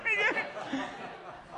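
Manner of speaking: high, open, hearty laugh; big laugh. Real, not acted. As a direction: let the laugh ring out. Laughing after the joke.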